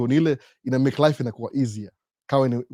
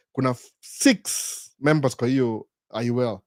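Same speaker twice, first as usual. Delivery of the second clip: normal talking voice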